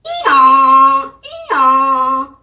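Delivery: loud braying hee-haw; loud donkey bray that makes her laugh. Shown as a demonstration. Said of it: "This is a donkey".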